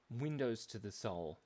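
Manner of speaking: ordinary talk, nothing marked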